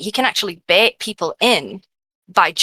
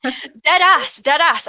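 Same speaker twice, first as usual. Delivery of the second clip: excited; 9 semitones higher, much slower than usual. Real, not acted. Excited agreement.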